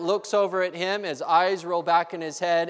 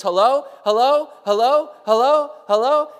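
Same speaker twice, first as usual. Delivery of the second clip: hello, hello repeated higher and louder, each rising; calling out; 5 semitones higher, 5 dB louder than usual. Acted. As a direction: call into the radio, hoping anyone answers. Untrained man calling on radio.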